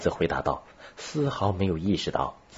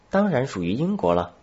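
higher-pitched, self-assured pupil's answer; confident child; 7 semitones higher, 4 dB louder than usual. A narrator's reading. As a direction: answer like a child sure of the lesson. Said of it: "孩子回答得颇为自信".

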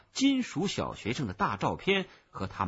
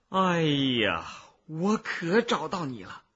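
louder, drawn-out exclamation of discovery; excited relief; 3 semitones higher, 4 dB louder, slower than usual. Acted. Cicada finding the little owner, exclaiming excitedly. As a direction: cry out on finally finding them.

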